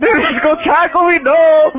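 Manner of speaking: very high, comic alarm; retold panic. Telling friend she charged at him, high mock panic.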